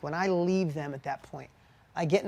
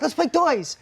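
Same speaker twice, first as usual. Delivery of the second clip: put-on higher, louder childlike plea; a touch of a small boy begging to play; little son asking; 9 semitones higher, 6 dB louder, slower than usual. Son asking dad to play, lightly voiced kid. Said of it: "he's like".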